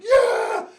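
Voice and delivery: high, loud victorious yell; cheering shout. Retold or imitated. His bus celebrating the hit, loud cheer. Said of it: "our whole bus was like".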